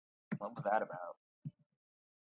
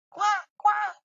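duck quack; 7 dB louder than usual. Pretend. Imitating duck/chocobo sound.

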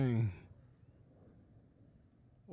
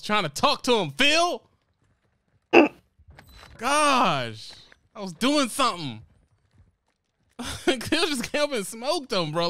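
high, loud exasperated rant; excited outburst; 8 semitones higher, 7 dB louder, slower than usual. Real, not acted. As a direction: vent loudly, half laughing. Frustrated after teammate steals kill.

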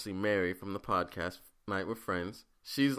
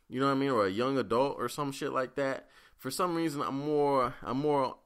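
usual speaking voice

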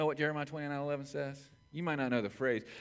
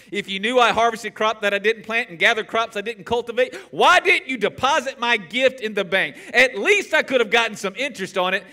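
stern master; 8 semitones higher, 12 dB louder than usual. Acted. Master's rebuke read aloud.

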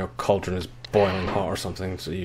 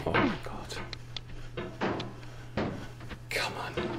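whispering; 9 dB quieter than usual. Real, not acted. Tense climbing moment, whispered.

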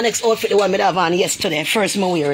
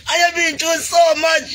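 raised, slow, tearful cry; 6 semitones higher than usual. Real, not acted. To her viewers about her hardship, crying out slowly. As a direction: wail it out through tears.

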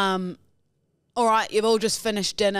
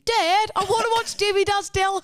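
very high mock child voice; begging kid; 13 semitones higher, 4 dB louder than usual. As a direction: whine to Dad like an eager kid. Acting a child asking Dad.

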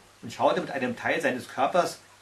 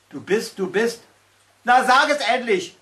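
building to a loud, high impatient demand; impatient groan; 7 semitones higher, 6 dB louder than usual. A narrator's reading. Slug urging the stuttering troll, impatient. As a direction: lose patience and push. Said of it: "stöhnte die Riesennacktschnecke".

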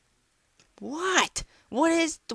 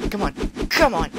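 normal talking voice

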